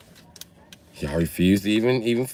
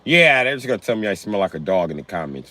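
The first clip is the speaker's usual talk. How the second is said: ordinary talk, nothing marked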